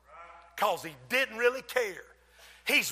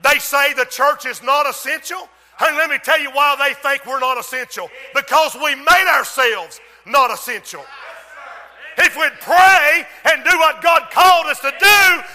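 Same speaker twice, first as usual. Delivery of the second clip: loud, raised pitch, building to a shout; full-force preaching; 6 semitones higher, 10 dB louder than usual. Real, not acted. Preacher rebukes the church for blaming others, full-force preaching. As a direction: preach it hard, pitch climbing with each phrase.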